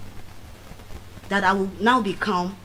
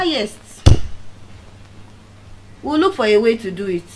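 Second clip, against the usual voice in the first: ordinary delivery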